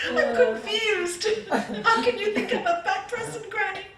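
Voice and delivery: high, loud imitation of a confused child; mock tearful wail. Crying granddaughter challenges her granny, high mock tearful wail. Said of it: "she starts crying".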